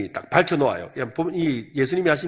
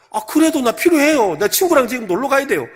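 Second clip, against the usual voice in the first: put-on whiny child; 6 semitones higher, 3 dB louder than usual. Child insists on allowance.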